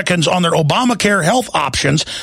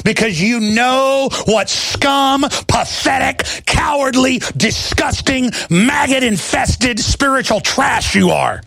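furious slow tirade; 6 semitones higher, slower than usual. Real, not acted.

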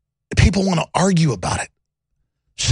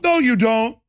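imitating Bolling; 5 semitones higher than usual.

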